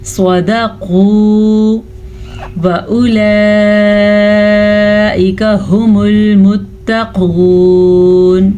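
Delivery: slow, drawn-out Quran recitation; tajweed recitation. Shown as a demonstration. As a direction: chant it slowly, stretching the vowels. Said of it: "قاف پیش واو قو". (تجوید کے ساتھ آہستہ تلاوت)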